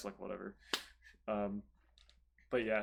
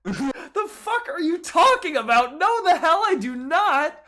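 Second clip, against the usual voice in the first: outraged disbelief; 10 semitones higher, 7 dB louder than usual. Retold or imitated. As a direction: blast her with disbelief, pitched way up and loud. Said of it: "I'm like".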